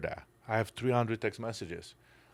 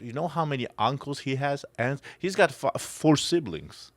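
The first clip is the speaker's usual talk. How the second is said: emphatic outrage; 5 semitones higher, 6 dB louder than usual. Real, not acted. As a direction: hammer out each word in disbelief. Outraged at son's neglect.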